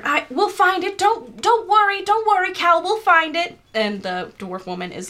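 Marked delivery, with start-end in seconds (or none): 0.0-3.6 high, anxious reassurance; high-pitched, hurried-sounding comforting; flustered consoling. Acted. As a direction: soothe someone who is wailing. Morgana consoles sobbing dwarf woman.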